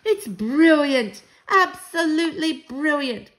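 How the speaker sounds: loud, high, drawn-out praise; delighted shout. A narrator's reading. Grandmother cheers the child's idea, shouting. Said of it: "she shouted".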